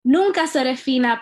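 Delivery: Nunca seré fina declared loud and proud; bold opening line. Shown as a demonstration. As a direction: open the poem by punching out Nunca seré fina. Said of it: "opening line of her spoken-word poem".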